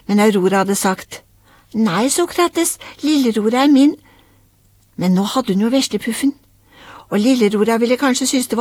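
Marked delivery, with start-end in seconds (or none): 1.7-4.0 lightly voiced girl claiming her doll; a touch of childish firmness; possessive little girl. A narrator's reading. Child refuses to share doll.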